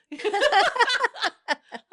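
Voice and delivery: loud high burst of laughter; real laugh. Real, not acted. Laughing hard at her own funny story, real laughter.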